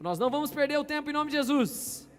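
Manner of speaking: preached high and loud; rising preacher's proclamation; emphatic declaration. Real, not acted. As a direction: declare it to the whole church.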